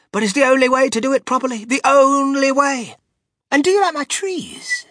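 high, loud exclamation of delight; excited cry. A narrator's reading. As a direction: cry it out with rising enthusiasm. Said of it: "he cried".

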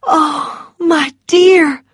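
high, distressed exclamation; crying out. A narrator's reading. Melanie to bereaved Missus Meade, crying out in sympathy. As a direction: cry out in sympathy. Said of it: "cried Melanie".

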